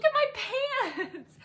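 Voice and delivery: high squeal breaking into a laugh; laughing in shock. Retold or imitated. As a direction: giggle through the words, stunned. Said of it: "And I'm, like, laughing".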